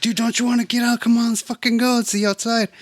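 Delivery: high, fast, insistent plea; acted urgent pleading. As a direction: beg them to come along right now. Acted pleading with resisting boy.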